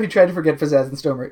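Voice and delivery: ordinary delivery, nothing marked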